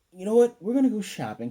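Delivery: loud, high-pitched acted announcement; excited announcement. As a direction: burst out with a sudden bright idea. Voicing mom's sudden shopping plan.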